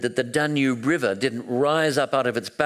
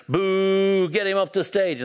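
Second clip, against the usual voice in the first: high, drawn-out boo and jeer; mock crowd heckle, pitched high; imitated booing crowd; 8 semitones higher, slower than usual. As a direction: heckle him off like an angry audience. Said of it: "they think he's arguing against the revolution".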